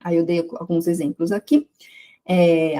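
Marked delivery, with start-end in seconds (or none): none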